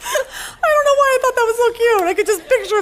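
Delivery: high, laughing through the words; real laughter. Real, not acted. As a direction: crack up while saying it. Laughing at her own joke.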